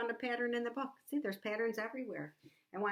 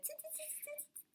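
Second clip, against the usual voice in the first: quick repeated tongue clicks; chattering monkey tsks; monkeys' tsk-tsk; 17 dB quieter than usual. A narrator's reading. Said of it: "and they said".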